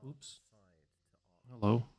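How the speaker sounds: echoing mic check; room echo. Real, not acted. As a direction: voice bouncing back in a big room. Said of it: "Sorry for the echo".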